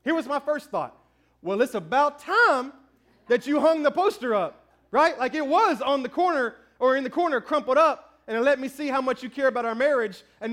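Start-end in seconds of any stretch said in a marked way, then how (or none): 1.4-4.7 a husband's sarcastic jab, slowed down; mock snarky remark. Husband's unspoken jab at his wife, mock sarcastic. Pastor voicing unsaid snarky thought.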